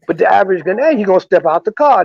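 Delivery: ordinary delivery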